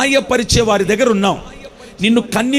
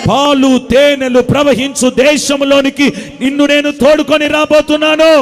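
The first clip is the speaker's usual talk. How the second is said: preaching at full force; 5 semitones higher than usual. Acted. Preacher promising deliverance to the congregation, building to a shout. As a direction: drive it home to the crowd.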